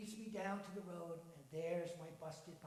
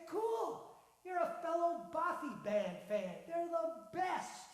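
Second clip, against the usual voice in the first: excited fan; 9 semitones higher, 9 dB louder, slower than usual. Acted. Excited fan recognizing logo.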